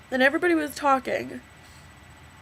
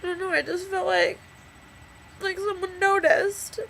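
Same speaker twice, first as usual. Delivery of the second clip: real tears, voice high and breaking; crying; 9 semitones higher than usual. Real, not acted. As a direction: cry through the words. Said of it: "I'm crying into a video".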